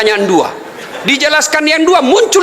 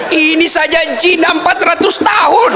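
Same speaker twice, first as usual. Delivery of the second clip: laughing shout; 8 semitones higher than usual. Real, not acted. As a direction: belt out the punchline, laughing. Punchline shouted to audience.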